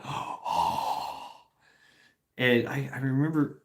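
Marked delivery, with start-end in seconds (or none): 0.0-1.3 sharp gasp, then a soft voiceless "Oh"; imitating her relief as the bra comes off; relieved gasp and sigh. Imitating his girlfriend's relief at home, a gasp then breathy sigh. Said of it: "the sigh of relief in, in her face was like".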